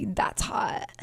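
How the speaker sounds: breathy whisper of her catchphrase. Retold or imitated.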